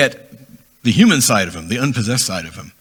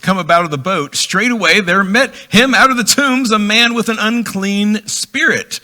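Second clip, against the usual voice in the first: scripture reading; 7 semitones higher, 4 dB louder than usual. Acted. Reads scripture aloud.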